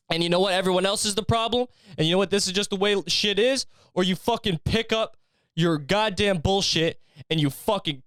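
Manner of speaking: high, intense drill-sergeant motivation; "You got two choices" barked high and firm, no laughing; David Goggins pep talk. Pretend. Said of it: "playing fucking David Goggins audio".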